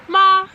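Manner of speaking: ma held high and level; ma on a high flat pitch; first tone ma. Shown as a demonstration. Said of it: "you start at the top, higher pitched, one tone".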